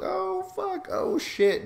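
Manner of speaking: high, loud mock gasp of shock; hammed-up crowd reaction.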